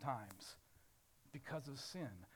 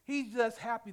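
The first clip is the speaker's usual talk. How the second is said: ordinary delivery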